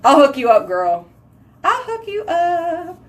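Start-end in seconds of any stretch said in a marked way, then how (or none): 1.6-3.0 high, drawn-out and light; playful sing-song. Real, not acted. Offering to hook a girl up, playful sing-song.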